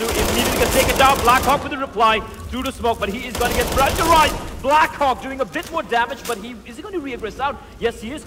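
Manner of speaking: lively play-by-play call; esports caster. Acted. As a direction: call the fight with pace.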